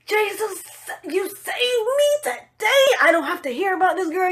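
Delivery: a shouted, tearful thank-you to Jesus; overjoyed cry to Jesus. Retold or imitated. Thanking Jesus in her mind.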